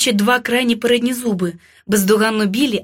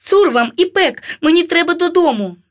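high, loud, insistent cry; frightened shout; 6 semitones higher, 4 dB louder than usual. A narrator's reading. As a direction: shout in protest, pitch raised. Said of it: "закричала я". (крик протесту високим голосом)

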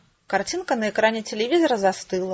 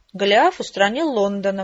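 plain reading voice

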